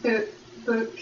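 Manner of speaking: boot said with a long oo like a Brit saying book; accidental British vowel. Shown as a demonstration. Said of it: "I'll say, like".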